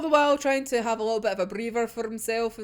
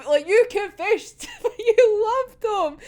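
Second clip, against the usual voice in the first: laughing; 10 semitones higher, 5 dB louder, slower than usual. Real, not acted. Speaker teasing, laughing.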